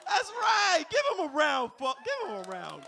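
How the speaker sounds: high loud cheer; excited shout. Real, not acted. Comic cheering the audience's correct guess, loud and high.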